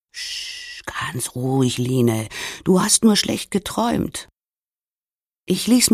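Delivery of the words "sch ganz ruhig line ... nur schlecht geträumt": soothing. Acted. Soothing partner after a nightmare.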